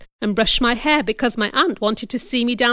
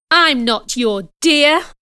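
slow, louder, higher indignant retort; angry reply; 5 semitones higher, 5 dB louder, slower than usual. A narrator's reading. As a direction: throw it back angrily, word by word. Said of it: "I replied angrily".

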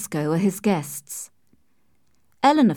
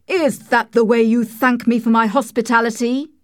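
loud, raised, indignant voice; angry shout; 4 semitones higher, 6 dB louder than usual. A narrator's reading. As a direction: shout the question in outrage at an ungrateful guest. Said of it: "he shouted at me".

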